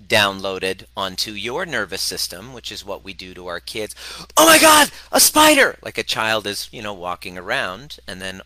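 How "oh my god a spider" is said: shrieking high and loud in terror; panicked scream. Retold or imitated. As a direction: scream it like you saw a spider. Parent panics at child's spider.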